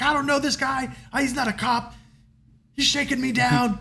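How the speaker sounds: high, protesting voice without real volume; mock screaming. Captive to the crowd, high mock-screamed protest. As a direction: imitate a panicked scream for help. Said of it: "he's screaming at the top of his lungs".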